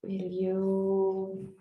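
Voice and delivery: normal talking voice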